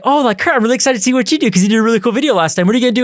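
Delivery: high, fast and eager, imitating the professor. Imitating the professor excitedly asking the student's plan, high and fast. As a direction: gush like a hopeful teacher expecting great things.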